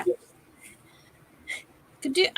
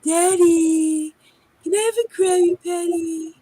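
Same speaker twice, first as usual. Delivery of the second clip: higher, loud whiny whale daughter; Pearl the whale; 5 semitones higher, 5 dB louder, slower than usual. Retold or imitated. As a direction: beg Daddy like a spoiled cartoon whale. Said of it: "there's Pearl the whale".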